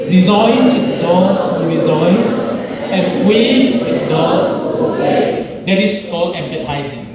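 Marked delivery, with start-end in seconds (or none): 0.1-5.7 loud, slow, half-sung recitation; group scripture reading. Shown as a demonstration. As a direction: chant the verse together. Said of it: "Can you read this scripture?".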